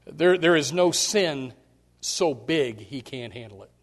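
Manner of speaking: ordinary talk, nothing marked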